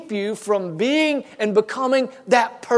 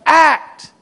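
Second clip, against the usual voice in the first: higher, louder single-word command; David ordering his son: Act; sharp fatherly order; 6 semitones higher, 6 dB louder than usual. Retold or imitated. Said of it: "David is saying to his son".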